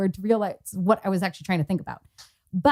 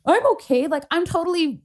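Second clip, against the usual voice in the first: defensive insistence; 8 semitones higher than usual. Retold or imitated. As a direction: insist a bit too hard, pitched up. Acts insisting she was fine.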